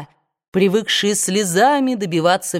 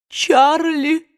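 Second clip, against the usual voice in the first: higher, sobbed name; sweet sob; 9 semitones higher than usual. A narrator's reading. Aunt sobs her dead nephew's name, sweetly tearful. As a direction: savor the grief in the sob. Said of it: "сладко всхлипнула тётушка Питтипэт".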